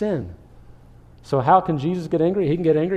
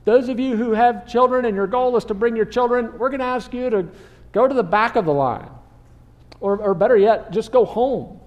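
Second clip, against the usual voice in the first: slightly higher, projected crowd announcement; lightly voiced crowd-managing disciples; 6 semitones higher, 3 dB louder than usual. Disciples going around the crowd turning parents away, lightly acted announcement. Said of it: "disciples are going around in the large crowd saying".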